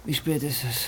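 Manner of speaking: drowsy partner woken too early; sleepy. Acted. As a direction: mumble half asleep in bed.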